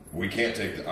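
normal talking voice

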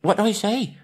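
high, gasping little hobbit voice. Acted. Bilbo gasping at the troll, high and breathless. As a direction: squeak it out between gasps.

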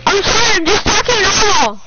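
high shouted apology; screaming. Real, not acted. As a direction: yell it while claiming to talk normally. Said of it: "you should stop screaming".

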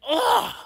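sudden loud high angry grunt; loud outraged grunt of disgust; snort of anger. Retold or imitated. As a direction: burst out with an indignant snort. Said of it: "it's like a, a snort of anger".

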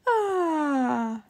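drawn-out sleepy yawn. Acted. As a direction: yawn it out, half asleep. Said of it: "yawned Daddy Pig".

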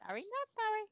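high, squeaky sing-song echo; silly mimicking. Player echoes a friend's catchphrase, silly mimicking.

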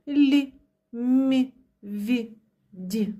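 лі, мі, ві, ді palatalized, light as feathers; feather-soft лі, мі, ві, ді, one by one; soft consonants. Shown as a demonstration. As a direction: say лі, мі, ві, ді softened with an "ee" colour. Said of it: "як пір'їнки".

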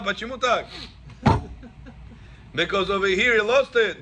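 ordinary talk, nothing marked

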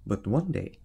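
ordinary delivery, nothing marked

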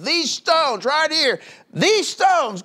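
high, slow, loud prophet's cry; mock preaching shout. Preacher voicing the Baptist to the crowd, loud and high.

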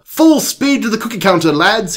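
high, eager British command; British doctor rallying. Acted. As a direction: call the troops forward, British accent. British doctor rallies his dogs.

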